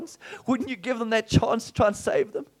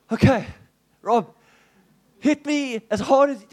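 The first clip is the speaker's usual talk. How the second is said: loud; 6 semitones higher, 7 dB louder than usual. Retold or imitated. Bracing for the punch.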